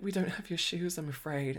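high, mildly sheepish retold apology; apologetic. Shop worker telling a star shoes are missing, mildly apologetic. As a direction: imitate breaking bad news gingerly. Breaking bad news to [name].

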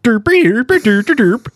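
high, silly mock Swedish gibberish; Swedish Chef voice. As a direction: do the Muppet chef. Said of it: "in the Swedish chef voice".

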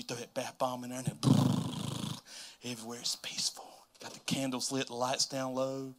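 soft, low, soothing voice; peaceful. Real, not acted. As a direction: ease into a hushed, relaxed murmur. Evokes peaceful candlelit bubble bath.

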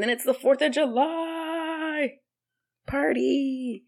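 high, slow hyped-up announcement; announcing the party in a hyped-up tone; excited. Real, not acted. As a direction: build it up like big news.

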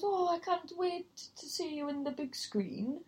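a half-held Welsh lilt that slips; Welsh accent. Retold or imitated. Drunk woman praising the compere, in a slipping Welsh accent. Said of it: "I feel like I lost the accent there".

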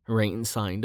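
plain reading voice

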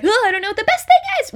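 high, loud, rushed fretting; mock panic. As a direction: imitate someone freezing up in a fluster. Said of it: "We all shut down with that".

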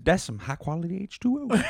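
high-pitched goofy character voice; Waterboy Sandler. Acted. Quoting the movie to a friend, high character voice. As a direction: pitch it up into a silly movie impression. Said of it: "What about Waterboy Sandler?".

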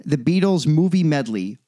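ordinary talk

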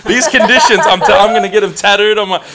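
overexcited bragging that climbs into a shout; excited outburst. Acted. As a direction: build from eager to high, loud, full-blown ranting. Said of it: "got a bit excited when she said".

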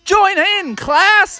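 high-pitched, singsong summons; calling out. Acted. Teacher calling class into the song, high rallying call. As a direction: invite everyone to join. Teacher calls over whistling.

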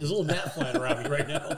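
giggling through the words; laughing. Real, not acted. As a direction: laugh while you say it. Gnat buzzing around, laughing.

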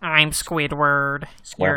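slow nasal grumpy cartoon voice; nasal Squidward voice. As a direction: drawl it through the nose like Squidward. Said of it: "you talk in the nasally voice".

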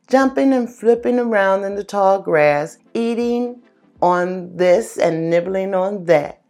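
animated storytelling voice; lively story narration. A narrator's reading. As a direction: tell the fable with energy.

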